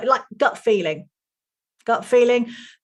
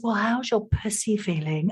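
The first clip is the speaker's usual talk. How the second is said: softer, cooing mock of a spiritual coach; 2 semitones lower, 6 dB quieter than usual. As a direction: send up the gushing coach, softer. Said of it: "when someone comes up and says".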